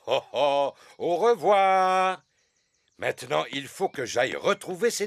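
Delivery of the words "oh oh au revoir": calling goodbye. Acted. Waving off the flying plane.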